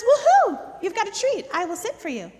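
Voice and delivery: squealing high and loud, then settling; a thrilled dog spotting the treat; excited dog. Pretend. Voicing the dog who sees the cookie, excited. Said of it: "Your dog says".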